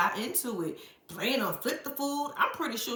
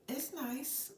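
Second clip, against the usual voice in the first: flat dismissive reply; 3 semitones higher, 10 dB quieter than usual. Retold or imitated. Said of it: "Gammy was like".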